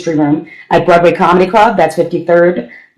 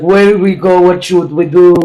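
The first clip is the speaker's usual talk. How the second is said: put-on thick comic Russian accent; Russian-accented English, hammed up; mock Russian accent. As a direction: play a puzzled Russian immigrant. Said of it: "all the Russians who are now".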